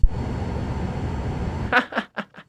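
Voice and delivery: high, breathy burst of laughter; real laughter. Real, not acted. Reviewer amused by a needless little detail, real laughter. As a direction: crack up helplessly.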